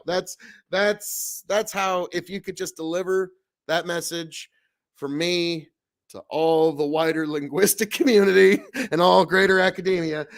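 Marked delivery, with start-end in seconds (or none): none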